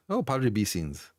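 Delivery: usual speaking voice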